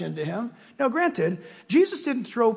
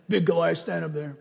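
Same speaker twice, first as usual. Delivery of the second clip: ordinary talk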